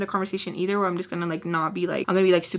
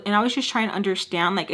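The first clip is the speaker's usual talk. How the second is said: normal talking voice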